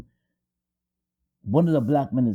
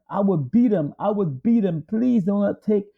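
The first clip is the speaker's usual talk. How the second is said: higher pleading voice, a touch of desperation; wife pleading for the boy, higher and urgent; 6 semitones higher than usual. Retold or imitated. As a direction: beg them to spare the boy. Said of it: "she pleaded with them".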